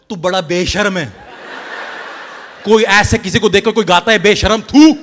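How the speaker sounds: loud scolding in Hindi, spitting at the end; angry Hindi retort. Retold or imitated. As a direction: scold him loudly. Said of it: "So I replied".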